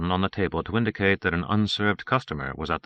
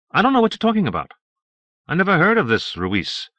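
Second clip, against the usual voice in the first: high-pitched, quick protest of innocence; high, insistent denial; protesting ignorance; 10 semitones higher, 5 dB louder than usual. Acted. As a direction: act baffled by the accusation, pitched high. Suspect feigning ignorance under questioning.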